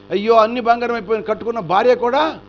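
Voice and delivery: a preacher's hammed-up weeping; loud lament. A narrator's reading. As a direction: grieve aloud over the golden wife. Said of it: "ఏడుస్తుంటే".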